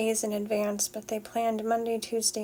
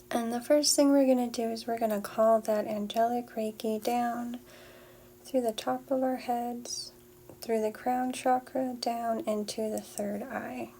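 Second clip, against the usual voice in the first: airy breathy mystical healer. Acted. Leads a Reiki clearing.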